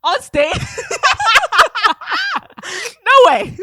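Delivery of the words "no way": high, loud burst through real laughter; can barely believe it, cracking up; laughing disbelief. Real, not acted. Co-host hears of crying on stage, laughing disbelief.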